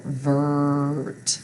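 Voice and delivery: "vert" stretched on the er sound; drawn-out R syllable. Shown as a demonstration. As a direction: hold the R-colored vowel in "vert". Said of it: "that's an R syllable".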